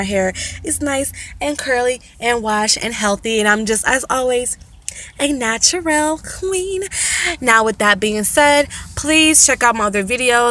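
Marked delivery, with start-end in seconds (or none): 5.2-6.8 proud flourish. Real, not acted. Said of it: "self-praise flourish".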